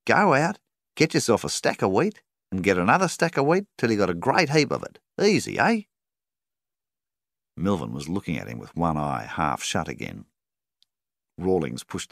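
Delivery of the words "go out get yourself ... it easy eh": much higher, sweetly coaxing banker voice; butter him up with a high, honeyed tone; very nicely. A narrator's reading. Banker coaxing a struggling farmer, high and sugary. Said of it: "He said it all very nicely".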